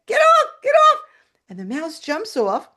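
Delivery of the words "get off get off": high, loud shrieks of alarm; panicked screaming. Retold or imitated. Woman with a mouse on her shoulder, panicked screaming. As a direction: scream to shake it off.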